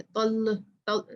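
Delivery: ordinary talk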